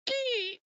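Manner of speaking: high squeaky "skee" giggle; squeaky snicker. Retold or imitated. Friend about to swing the camera, high squeaky giggle. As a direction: mimic the ghost friend's sneaky high laugh. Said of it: "he's gonna go".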